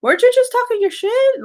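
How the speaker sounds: high, disbelieving call-out; shrill mock outrage at fickle fans; incredulous challenge. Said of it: "I was like".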